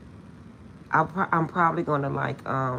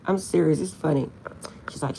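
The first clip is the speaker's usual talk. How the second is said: normal talking voice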